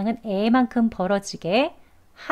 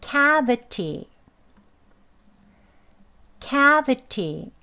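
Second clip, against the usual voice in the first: CAvity, slow, with a big stretched CA; slow teacher's model of cavity, crisp T; stress on first syllable; 3 semitones higher, much slower than usual. Shown as a demonstration. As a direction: punch CA in cavity and relax the V. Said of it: "강세 중요합니다".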